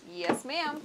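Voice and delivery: high, put-on American twang; American "yes, ma'am". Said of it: "Or rather".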